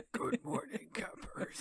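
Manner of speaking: lightly voiced alien, whispered ending; mock Goa'uld alien voice. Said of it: "my Goa'uld voice".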